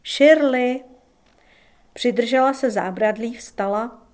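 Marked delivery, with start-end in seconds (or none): 0.0-0.8 loud call toward the steps; calling out again. A narrator's reading. Tommy calling to his wife on the steps, loud call. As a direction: call her name out, louder. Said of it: "zavolal na ní".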